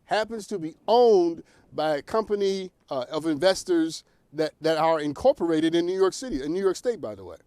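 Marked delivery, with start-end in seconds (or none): none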